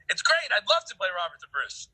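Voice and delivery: high, loud burst of enthusiasm, easing off; mock-eager yes with a pitch leap; eager acceptance. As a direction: jump at the offer, breaking into a laugh. Eager actor retelling his reply.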